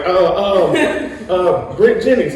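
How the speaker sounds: high, loud, hesitant blurt; hammed-up groping for a name under pressure; flustered stammer. Young actor put on the spot by his idol, mock flustered.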